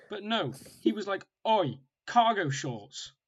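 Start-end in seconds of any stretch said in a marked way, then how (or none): 1.5-3.1 slow, drawn-out taunt, loud; spiteful jeer. Retold or imitated. Mimicking the park kid jeering at his cargo shorts, slow and spiteful. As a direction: sneer it out word by word. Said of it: "he said it really spitefully".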